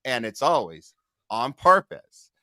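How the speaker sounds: news anchor read. Acted. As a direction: announce it like a TV news opener. Opens news segment as host.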